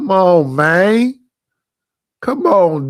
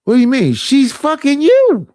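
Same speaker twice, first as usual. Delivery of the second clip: incredulous; 7 semitones higher than usual. Retold or imitated. Incredulous retort to an interviewee.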